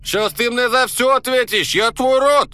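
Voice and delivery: put-on Caucasian accent, starting firm and building to a high furious yell; loud Caucasian accent. Hidden voice threatening Borya through the smoke, Caucasian accent rising to a high yell. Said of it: "с кавказским акцентом чей-то громкий голос".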